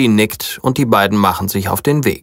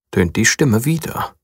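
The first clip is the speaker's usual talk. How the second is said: ordinary delivery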